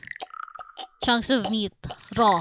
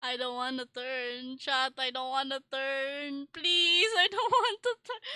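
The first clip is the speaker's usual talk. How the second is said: small, high whine climbing higher; scared whimper; 6 semitones higher, 3 dB quieter than usual. Real, not acted. Streamer begging chat not to turn, scared whimper. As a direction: whimper a plea, rising in pitch.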